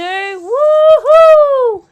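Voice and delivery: loud high woohoo; excited whoop. Acted. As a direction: cheer with delight down the slide. Sliding down the water slide.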